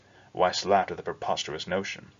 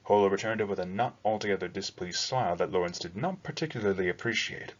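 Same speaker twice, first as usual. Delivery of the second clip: usual speaking voice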